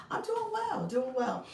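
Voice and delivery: cheerful. Real, not acted. Cheerful answer to viewer.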